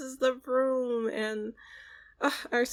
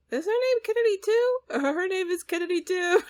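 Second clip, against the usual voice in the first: much higher and louder, amazed; surprised; 10 semitones higher, 6 dB louder than usual. Real, not acted. Streamer noticing two Sims named Kennedy, delighted surprise. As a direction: squeal it in happy disbelief. Noticing Sim shares a name.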